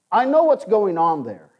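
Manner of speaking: ordinary talk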